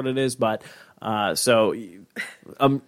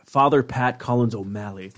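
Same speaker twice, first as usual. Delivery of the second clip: ordinary talk, nothing marked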